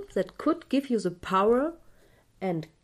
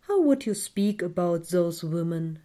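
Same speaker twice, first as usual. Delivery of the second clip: guided meditation. Acted. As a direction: guide the listener gently into calm.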